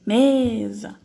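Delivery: "Mesa" with a long, loud ME; stress on first syllable. Shown as a demonstration. Teacher showing the strongest syllable, ME stressed and stretched. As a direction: lean hard on ME in "Mesa". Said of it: "minha sílaba mais forte".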